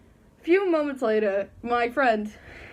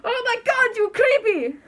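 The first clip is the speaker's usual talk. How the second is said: much higher, louder shriek of fright; terrified scream; 12 semitones higher, 6 dB louder than usual. Retold or imitated. Spanish teacher shrieking at a masked student, high and terrified. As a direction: scream it like a startled teacher in a panic. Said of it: "screaming at him, like, terrified".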